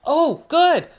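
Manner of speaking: put-on high, cheerful little girl piglet; Peppa Pig voice. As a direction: invite Daddy into the mud, high and bright.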